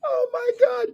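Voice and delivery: mock shocked glee; gleeful Marcy. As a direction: squeal it like the gloating neighbor. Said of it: "Marcy on the phone going".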